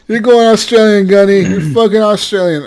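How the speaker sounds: loud and high, gleeful; excited teasing. Real, not acted. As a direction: declare it loudly, riding on laughter. Excited teasing amid laughter.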